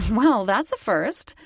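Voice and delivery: slow, amused line broken by a laugh. A narrator's reading. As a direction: let the laugh spill into the words. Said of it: "I laughed".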